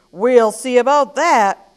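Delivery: loud buffalo roar; booming storybook retort. Acted. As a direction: roar it out as a challenge. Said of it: "roared the buffalo".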